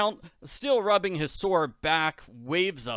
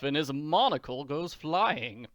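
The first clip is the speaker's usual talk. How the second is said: plain reading voice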